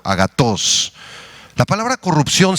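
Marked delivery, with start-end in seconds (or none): 0.0-0.9 agatos with the accent on the O; "agatos" said a-ga-TOS; Greek pronunciation. Shown as a demonstration. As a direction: stress the end of "agatos". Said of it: "con T H y acento en la O".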